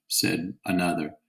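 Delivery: ordinary delivery, nothing marked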